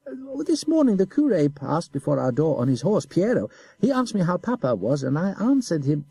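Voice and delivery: put-on high, innocent boy's voice; simple child. A narrator's reading. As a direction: play the guileless little boy. Boy tells evasive tale.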